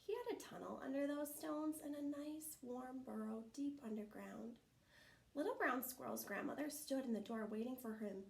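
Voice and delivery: plain reading voice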